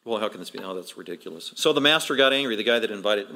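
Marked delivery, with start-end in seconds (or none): 0.0-1.5 low, soft aside; imitating a skeptical listener, hushed and low; muttered disbelief. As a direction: scoff quietly under your breath.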